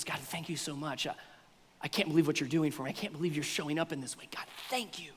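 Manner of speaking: quietly overwhelmed with gratitude; grateful hushed prayer. Retold or imitated. Preacher voicing a grateful believer praying, softly and tenderly.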